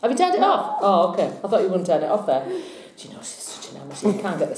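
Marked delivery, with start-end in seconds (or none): 0.0-0.8 high-pitched, real protest; alarmed protest. Real, not acted. Presenter to person filming who may have turned it off, alarmed. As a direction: stop them switching off, voice shooting up.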